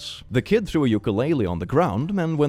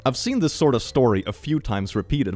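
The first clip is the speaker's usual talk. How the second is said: ordinary talk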